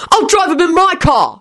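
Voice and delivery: high-pitched, contained shout. A narrator's reading. William cutting in with dismay, shouting. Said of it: "shouted William".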